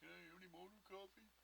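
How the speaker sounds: mock hater voice. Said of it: "They might go with a joke".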